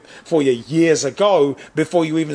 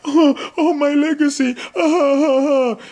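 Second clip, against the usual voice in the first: high, wailing fake cry; mocking Theresa May's tearful resignation; mock sobbing; 10 semitones higher than usual. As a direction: ham up a self-pitying sob. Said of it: "it was like".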